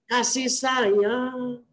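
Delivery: softly drawn-out, very slow appeal; tender. Real, not acted. Preacher urging care for orphans, tender and slow. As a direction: let the words linger gently.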